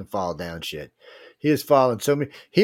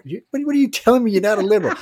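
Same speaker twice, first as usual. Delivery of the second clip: loud, fast, high-pitched challenge; arguing caller; 10 semitones higher, 6 dB louder, faster than usual. Retold or imitated. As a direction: shout him down fast. Calling in, arguing with host.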